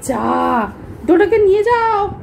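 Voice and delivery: ordinary talk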